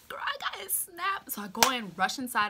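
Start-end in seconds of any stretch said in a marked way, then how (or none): 0.1-1.3 high, drawn-out breath of excitement; mock squeal kept under the voice; giddy whisper-squeal. Girl retelling getting his Snap, giddy whispered squeal.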